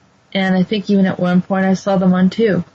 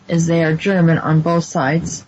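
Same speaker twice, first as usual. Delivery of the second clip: ordinary talk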